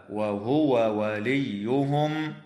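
وهو وليهم with both waws said clearly and separately; showing the two waws kept apart; clear double waw. Shown as a demonstration. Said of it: "يجب إظهار الواو عند الواو".

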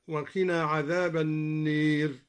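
النير said "an-neer" for an-naar; imala. Shown as a demonstration. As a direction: tilt the long a in النير to ee. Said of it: "وأمال النار من قوله تعالى".

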